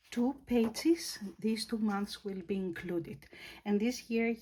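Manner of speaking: normal talking voice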